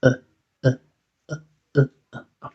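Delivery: a relaxed, neutral "eh" with the mouth slightly open; schwa sound. Shown as a demonstration. Teacher demonstrating the schwa vowel, relaxed. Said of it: "simplemente hacemos".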